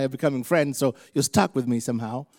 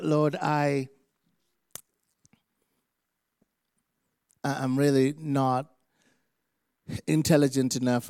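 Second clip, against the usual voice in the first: prayer. Real, not acted. Preacher asking God to speak through him, earnest prayer. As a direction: pray earnestly, phrase by phrase.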